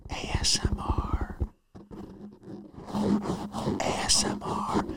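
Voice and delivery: imitating slow, hushed mic whisper; mock ASMR, whispered right into the mic. Comic mimics an ASMR video, whispering. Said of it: "just people whisper into the mic".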